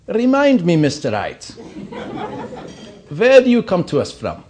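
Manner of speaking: high, loud, prim German dean voice; elderly German dean. Retold or imitated. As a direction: peer over your spectacles like an old German dean. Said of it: "delightful elderly German woman".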